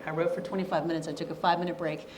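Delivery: fast, clipped-through repetition of the routine; rattling off the routine. Shown as a demonstration. Speaker repeating her writing routine to students, fast. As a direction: rush through it like a ticking timer. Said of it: "I did what's called the Pomodoro method".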